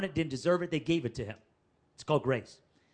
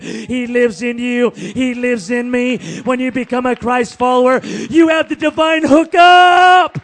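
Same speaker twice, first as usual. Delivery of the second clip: shouted, high, ringing preacher's declaration; preaching at full force; 6 semitones higher, 18 dB louder, slower than usual. Acted. Preacher proclaiming to his congregation, full shouted force.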